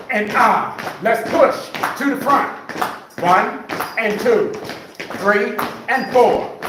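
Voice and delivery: high, energetic workout cues; aerobics instructor. Acted. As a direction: call out the beat to the class. Leads the marching cardio.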